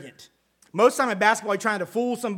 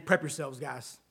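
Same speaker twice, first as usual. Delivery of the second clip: hushed warning; 6 semitones lower, 9 dB quieter than usual. Retold or imitated. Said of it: "he's basically saying".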